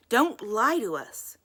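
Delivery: slow threatening warning; losing temper. A narrator's reading. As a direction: lose your temper at the liar. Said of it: "The Crows were losing their tempers".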